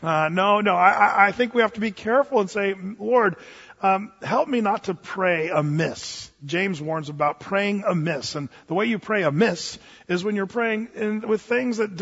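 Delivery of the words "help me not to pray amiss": ordinary talk, nothing marked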